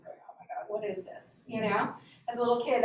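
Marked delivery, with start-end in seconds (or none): 0.1-1.4 unvoiced whisper of alarm; whispered dread. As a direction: breathe it out in panic. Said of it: "that was very conflicting".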